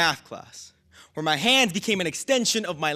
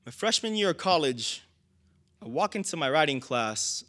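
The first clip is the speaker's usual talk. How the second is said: usual speaking voice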